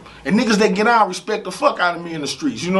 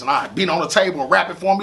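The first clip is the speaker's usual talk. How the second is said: ordinary talk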